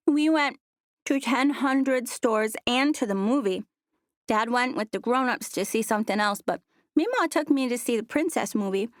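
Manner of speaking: put-on high, excited young girl; little girl voice. A narrator's reading. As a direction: talk like an eager little kid.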